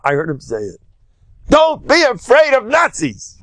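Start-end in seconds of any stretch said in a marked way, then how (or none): 1.5-3.4 much higher and slow, a mild echo of the rabbi's fervor; lightly voiced fiery rabbi; a hint of a fervent preacher; preaching imitation.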